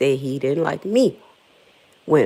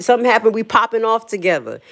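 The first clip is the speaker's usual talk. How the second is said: ordinary talk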